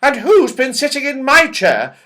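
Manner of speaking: a fussy mother bear asking. A narrator's reading. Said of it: "asked the mother bear".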